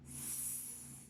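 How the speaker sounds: S as a long unvoiced hiss; tongue behind teeth, just hiss S; hissed S sound. Shown as a demonstration.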